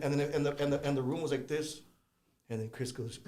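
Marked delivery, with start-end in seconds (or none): none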